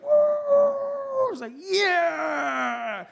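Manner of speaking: high, loud victory whoop; triumphant cheer. Retold or imitated. Driver cheering himself after swerving, high loud whoop. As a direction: crow like you just saved the day.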